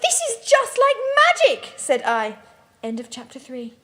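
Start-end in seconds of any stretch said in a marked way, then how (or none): 0.0-1.7 much higher, louder starry-eyed gush; awed delight. A narrator's reading. Teen girl flying through the sky, high dazzled gush.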